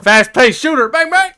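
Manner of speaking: excited announcer. Pretend. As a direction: pitch it like a game ad. Mock gunfire game pitch.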